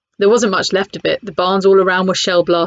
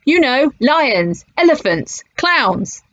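mock shout; 6 semitones higher, much slower than usual. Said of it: "so he shouted in English".

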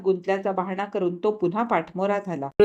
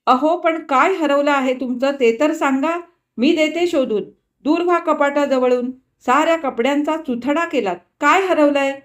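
shrieking demand; 6 semitones higher than usual. A narrator's reading. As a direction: demand an answer at the top of her voice. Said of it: "तिने किंचाळून म्हटले".

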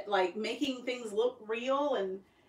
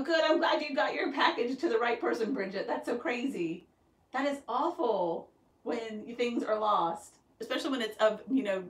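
usual speaking voice

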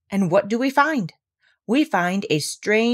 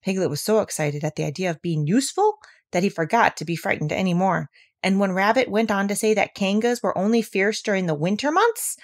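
usual speaking voice

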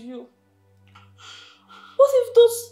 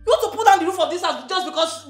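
raised, louder scolding; indignant yelling; 4 semitones higher, 8 dB louder than usual. Acted. Rose protesting the fuss over a car, indignant yelling. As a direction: protest loudly at the overreaction. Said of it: "Is Rose yelling at me".

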